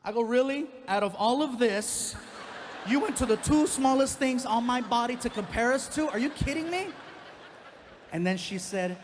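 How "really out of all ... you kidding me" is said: loud, high outraged disbelief; can't believe what he's hearing; indignant disbelief. Retold or imitated. Comic to his manager, loud, high outraged disbelief.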